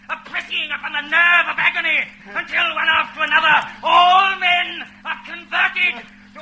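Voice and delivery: fervent rising rant about power; fanatical declaration. Acted. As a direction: preach power like a zealot. Interrogator's fanatical speech.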